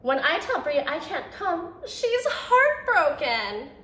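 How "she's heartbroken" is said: ordinary delivery, nothing marked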